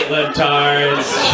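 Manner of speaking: ordinary talk, nothing marked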